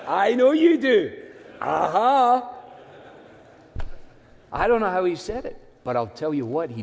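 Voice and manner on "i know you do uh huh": cheeky Glasgow wink in the voice; Glasgow accent. Retold or imitated. Preacher playing Paul with a Glasgow accent. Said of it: "if you came from Glasgow".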